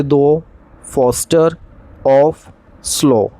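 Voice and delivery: "Though", "Foster", "of", "slow" said word by word, each set apart by a pause; spaced-out demo of the O vowel; picking out the O sounds. Shown as a demonstration. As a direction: pause after each word to show the repeated O. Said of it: "जैसे".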